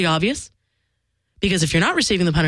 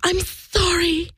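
much higher, slow and sweet little apology; cute and adorable; 12 semitones higher, much slower than usual. Retold or imitated. Nancy coming up to apologize, cute and adorable. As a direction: coo the apology like a cute kid. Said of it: "in her cute and adorable way".

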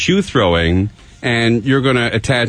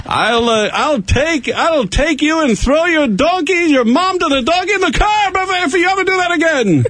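high, hammed-up Middle Eastern accent; joking Middle Eastern accent, laughing at the end. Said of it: "That was a nice Middle Eastern accent".

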